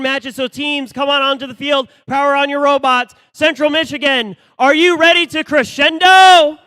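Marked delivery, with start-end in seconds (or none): 2.1-6.6 fighting game announcer. Real, not acted. Hyping the crowd before matches.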